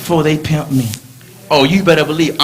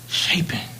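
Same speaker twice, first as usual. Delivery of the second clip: whisper; 2 semitones lower, 9 dB quieter than usual. Real, not acted. Whispered word.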